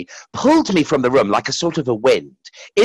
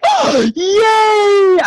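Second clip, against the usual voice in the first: very high, louder squeal of joy; shrieking oh yay; joyful yelp; 6 dB louder than usual. Retold or imitated. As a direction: burst out with delighted relief. Sudden relief and joy.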